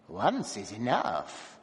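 low, slow, grim reply; cold and final. A narrator's reading. Dwarf answering his wife, low, slow and grim. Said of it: "the dwarf said grimly".

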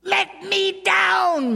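high, slow shrieking fury; furious scream. A narrator's reading. Dwarf groom raging at his bride, shrieking scream. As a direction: scream it out in rage. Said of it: "screamed in a paroxysm of fury".

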